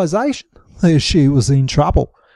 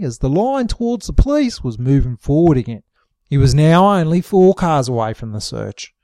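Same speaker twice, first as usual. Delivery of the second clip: plain reading voice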